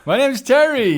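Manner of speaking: high, loud hello; bright loud greeting. Pretend. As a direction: announce yourself loudly. Mock formal greeting.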